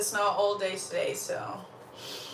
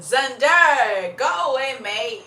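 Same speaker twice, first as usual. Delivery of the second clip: shooing someone away; 3 semitones higher, 7 dB louder, slower than usual. Real, not acted.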